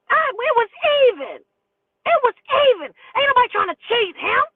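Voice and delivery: high, loud defensive whine; mock roommate protest. Accused roommate protesting the dope split, whiny.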